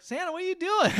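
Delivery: put-on very high-pitched child's voice; kid. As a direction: put on a little kid asking a question. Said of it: "then the kid shows up".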